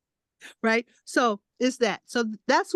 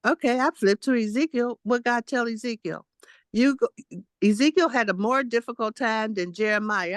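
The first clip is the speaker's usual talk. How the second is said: ordinary talk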